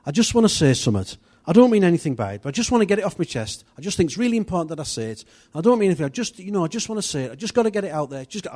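high, rushed, insistent imitation; wife's urgent plea. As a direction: imitate someone bursting to get it off their chest. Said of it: "she said".

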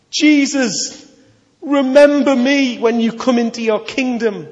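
high, loud plea; a dying man crying out to Jesus, pleading. Acted. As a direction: call out to him with everything left. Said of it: "he cries out to Jesus".

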